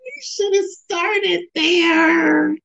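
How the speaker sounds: high, drawn-out wail of delight; gushing reaction. Real, not acted. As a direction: stretch every word out high, overcome with excitement. Gushing reaction right after watching the clip.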